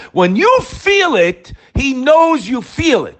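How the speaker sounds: a preacher rising to the peak; impassioned preaching. Real, not acted. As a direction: lift it up with fervor. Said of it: "preaching climax".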